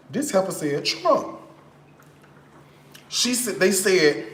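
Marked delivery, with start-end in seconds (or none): 0.8-1.4 high, dramatic Trump; mock shocked reveal. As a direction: deliver the punchline in disbelief.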